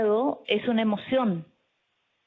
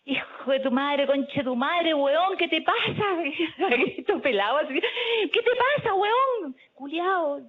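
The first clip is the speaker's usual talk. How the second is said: high-pitched reenacted outburst in Chilean slang; mock-yell Chilean curses, pitch shooting up; Chilean cursing shout; 7 semitones higher than usual. Woman reenacting how she cursed her mugger, high-pitched Chilean yell. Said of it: "con todas las malas palabras chilenas ... puedo hablar muy fuerte".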